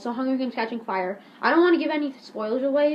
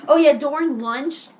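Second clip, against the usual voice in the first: usual speaking voice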